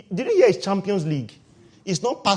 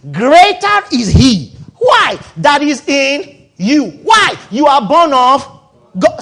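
slow, loud, drawn-out pulpit cry; full-force preaching; 7 semitones higher, 12 dB louder, slower than usual. Acted. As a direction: preach it out to the whole hall, stretching each word.

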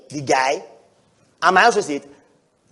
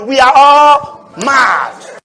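loud, higher, drawn-out cry; slow preaching shout; 6 semitones higher, 11 dB louder, much slower than usual. Real, not acted. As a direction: stretch every word out loud.

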